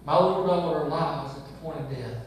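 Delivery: low, measured Bible reading; scripture reading. Acted. Pastor reading the Gospel aloud to the congregation, measured. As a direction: read the verses steadily before preaching.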